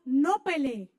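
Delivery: a loud, stern divine order; stern command. Acted. The Lord ordering the people, loud and stern command. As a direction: bark the command like God forbidding battle. God's command quoted in sermon.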